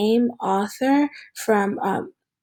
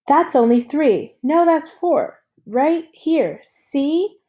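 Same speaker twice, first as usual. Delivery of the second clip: put-on higher, louder excited kid pointing it out; eager boy; 6 semitones higher, 4 dB louder, slower than usual. Alan Gold insisting to Alan that his clover has four leaves, eager. Boy voiced in read story.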